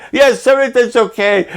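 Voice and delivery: high, weepy denial; tearful daughter. Retold or imitated. Daughter on the phone, crying, high-pitched and tearful. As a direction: brush it off through tears. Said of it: "called me crying".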